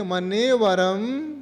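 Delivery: slow, drawn-out verse recitation; recitation for repetition. Shown as a demonstration. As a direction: lead pupils phrase by phrase. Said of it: "हाँ, बोलिए".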